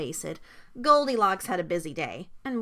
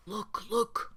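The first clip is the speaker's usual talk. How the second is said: hushed, softer urgent murmur; whispered warning; 6 dB quieter than usual. A narrator's reading. Papa Bear creeping to the bed, whispering. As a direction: keep it hushed, nearly a whisper. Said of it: "whispered Papa Bear".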